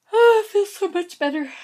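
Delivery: big loud sigh of relief, pitched high; relieved stretch. Real, not acted. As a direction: let it out like a full-body stretch. Stretching arms after deep breath.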